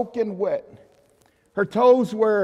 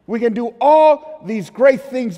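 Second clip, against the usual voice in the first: preaching build; 5 dB louder than usual. Acted. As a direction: drive it home to the congregation. Preaching climax to congregation.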